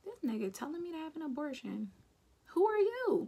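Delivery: rising anger, punch Who are you; angry at him urging an abortion; outraged. Retold or imitated. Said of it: "all I took from that was".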